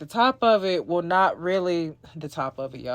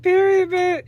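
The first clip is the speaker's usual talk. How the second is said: high, loud sassy declaration; sassy hype; 12 semitones higher, 4 dB louder than usual. Real, not acted. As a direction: clinch it with sassy pride. Hyping up her own work.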